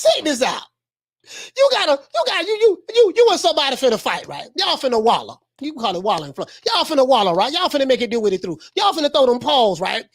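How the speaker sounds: normal talking voice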